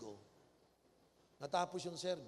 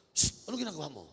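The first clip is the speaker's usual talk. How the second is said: scolding pastor; 8 dB louder than usual. Acted.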